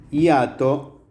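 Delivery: ordinary delivery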